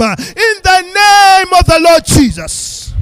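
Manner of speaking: loud, building prayer, rising to full force; fervent preaching prayer. Real, not acted.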